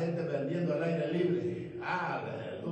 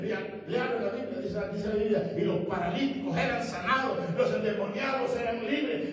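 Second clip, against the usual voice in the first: rising from brisk telling to a high, loud shout; building preaching; 5 semitones higher, 4 dB louder than usual. Acted. Preacher telling how Samaria believed, building to full force. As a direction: build steadily to a shouted climax.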